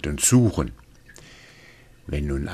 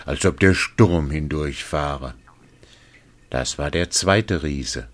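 ordinary delivery, nothing marked

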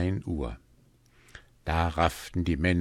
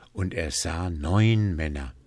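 usual speaking voice

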